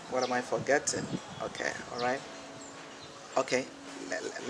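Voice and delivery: soft, low-key thinking aloud; under the voice, to oneself; talking to oneself. Real, not acted. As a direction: trail into a quiet aside while trying to remember. Muttering to self, lost thread.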